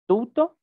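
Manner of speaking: "tuto" said high and loud, weight on the first syllable; stressed Greek word. Shown as a demonstration. Teacher showing students where the Greek accent falls, high and emphatic. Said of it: "siempre el acento ... pertenece al primero".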